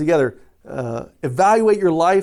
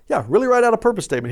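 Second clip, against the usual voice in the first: quick, higher-pitched insistence with a falling end; emphatic confirmation; 6 semitones higher, faster than usual. Shown as a demonstration. Preacher answering a doubting listener, emphatic confirmation. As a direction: confirm it briskly, leaning on "write".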